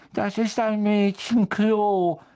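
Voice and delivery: slow, high-pitched wailing ghost voice; moping ghost girl. A narrator's reading. Ghost challenges intruders in her girls' toilet, high slow sulky voice.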